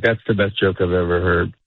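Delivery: low, unamused monotone; honest compliment that sounds like dislike; deadpan praise. Acted. Comic insisting they mean it, deadpan praise. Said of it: "It sounds like I hate you".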